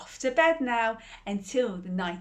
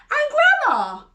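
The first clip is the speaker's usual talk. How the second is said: put-on little girl; 10 dB louder than usual. Girl questions wolf.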